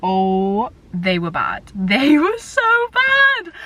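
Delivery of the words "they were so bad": slow and high, laughing through it; rueful laughing exaggeration; laughing emphasis. Real, not acted. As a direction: drag it out with a real laugh. Laughing while recalling bad phases.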